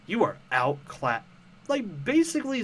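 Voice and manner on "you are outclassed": slow, lightly hammed-up cartoon villain; mock villain voice. Mocking a commenter's reply as a cartoon villain. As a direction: read it like a smug cartoon baddie. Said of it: "why are you talking like a fucking villain".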